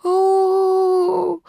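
put-on long, high childlike "oh"; little one's crestfallen sigh of a word; rather sad. A narrator's reading. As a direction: hold one drawn-out note of disappointment. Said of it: "сказал Ру довольно грустно".

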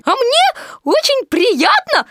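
very high, slow squeaky delight; joyful Piglet. A narrator's reading. Piglet echoes Pooh about his own gift, squeaky joy. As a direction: pipe it out gleefully, stretched. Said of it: "радостно сказал Пятачок".

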